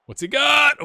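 high, loud shout of anticipation; excited shout. Real, not acted. Streamer watching a free kick, excited high shout. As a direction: yell it as the kick is taken.

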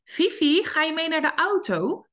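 high, friendly sing-song question to the dog; lilting invitation to come to the car; sweet first call. Shown as a demonstration. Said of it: "die beginnen eigenlijk zo".

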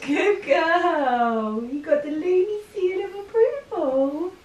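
pet talk. Real, not acted. Praising the pet dog.

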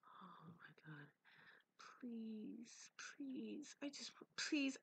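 hushed, terrified breathy prayer; scared whisper. Real, not acted. Terrified player whispering to himself while hiding, real fear. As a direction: breathe the words so nothing hears you. Pleading in fear during game.

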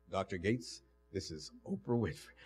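a low, soft imitation of Oprah; deep woman's voice. Oprah voiced on phone call.

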